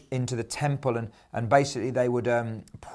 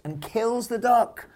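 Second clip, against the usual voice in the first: dramatic shock; 10 semitones higher, slower than usual. A narrator's reading. Shocking twist in the duck story.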